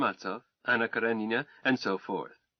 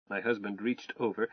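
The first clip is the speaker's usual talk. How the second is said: plain reading voice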